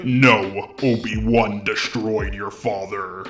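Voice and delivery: quiet understated Vader line; a hint of Vader. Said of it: "the actor for Darth Vader ... say".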